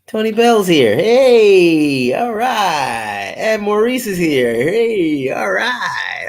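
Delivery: high, drawn-out hails; calling out greeting. Real, not acted. Host welcoming viewers as they join, calling out. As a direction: announce each arrival like a welcome cheer.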